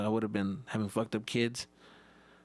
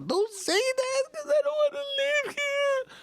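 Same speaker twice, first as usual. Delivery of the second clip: put-on very high, slow pleading child voice; whiny kid; 3 dB louder, slower than usual. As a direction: beg dad not to abandon you.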